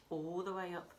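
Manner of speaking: usual speaking voice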